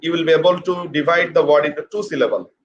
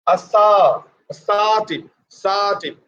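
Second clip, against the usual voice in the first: ASSERTIVE with SA raised and stretched, a-SAA-tiv; higher, longer stress; 3 semitones higher than usual. Shown as a demonstration. Teacher showing what higher and longer means, stressed a-SAA-tiv. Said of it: "pronounce higher".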